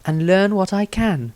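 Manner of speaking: a wizard setting a cautious plan. Acted. Wizard Maestro speaks.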